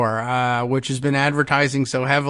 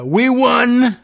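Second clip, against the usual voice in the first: loud mock triumphant yell; all-caps shout; 9 semitones higher, 5 dB louder than usual. Host reading Trump's post, shouting it mocking. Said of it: "all caps there".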